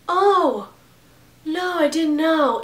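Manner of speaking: high, airy clueless "oh, no"; clueless roommate. Retold or imitated. Mocking the roommate who never noticed the extinguisher. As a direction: play the roommate dumbly surprised. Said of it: "And she's like".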